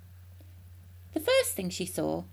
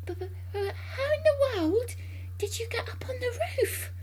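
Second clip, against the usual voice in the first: high stammer into a thin wail; stutter, then ask in a high, dismayed voice; bewildered cry. A narrator's reading. George's mother calling up to Grandma, crying out in bewilderment. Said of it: "cried the mother".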